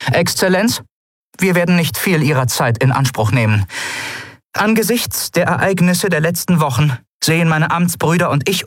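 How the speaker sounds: high, formal, emphatic declaration; resolute officer. A narrator's reading. Kalin demanding the governor's resignation, resolute and formal. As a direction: deliver demands with rising insistence.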